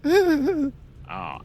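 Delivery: high wailing ghostly oh; unearthly voice. Acted. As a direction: cry out like a ghost. Mock ghostly cry reacting.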